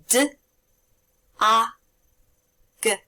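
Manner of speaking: "D, A, G" as three separate sounds; sounding out words. Shown as a demonstration. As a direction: segment "D, A, G" sound by sound with pauses. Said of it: "what word those sounds blend together".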